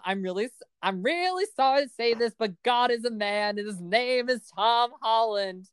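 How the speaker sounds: high-pitched gushing TikTok imitation. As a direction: recite it high, like a hammed-up fan confession. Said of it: "that TikTok".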